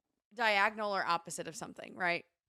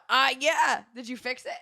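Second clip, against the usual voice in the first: ordinary talk, nothing marked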